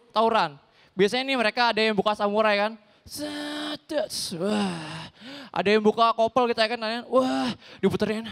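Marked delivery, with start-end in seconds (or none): 3.1-5.1 mock blade swish then soft gasp; hushed imitated sword draw; sword sound effect. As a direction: act out unsheathing a blade. Imitating drawing a samurai sword.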